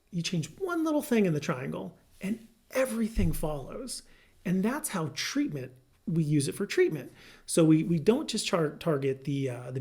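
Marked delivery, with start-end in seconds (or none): none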